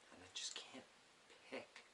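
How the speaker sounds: breathy whisper to himself; a real little whispered sigh of indecision; whispered aside. Real, not acted. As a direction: whisper it, torn between choices. Torn choosing a poem, aside.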